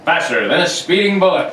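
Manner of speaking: put-on loud, punchy old radio intro; radio announcer. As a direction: boom it like a vintage announcer. Reads radio show opening.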